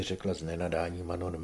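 plain reading voice

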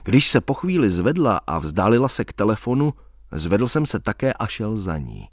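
ordinary delivery

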